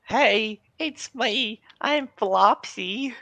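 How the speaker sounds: very high, exaggerated put-on voice; Flopsy. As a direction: squeak out a mock character introduction, drawn out. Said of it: "my Flopsy impression".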